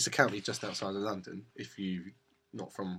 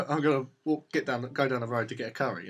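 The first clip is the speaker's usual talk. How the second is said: ordinary delivery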